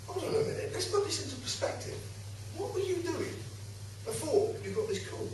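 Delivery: high-pitched, hushed indignant challenge; indignant protest. Retold or imitated. Householder questions the copper at the window, indignant. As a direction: challenge him in a high, soft voice. Retold doorstep argument with policeman.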